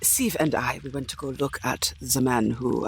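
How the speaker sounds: ordinary talk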